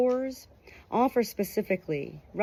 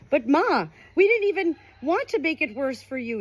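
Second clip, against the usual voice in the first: higher, louder startled protest; pitched-up hurt protest; taken aback son; 9 semitones higher, 4 dB louder than usual. Acted. A son protesting to his dying mother, higher and startled.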